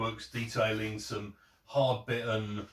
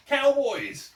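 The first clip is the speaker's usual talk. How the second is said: excited title call; 10 dB louder than usual. Acted. Announcing a rejected concept.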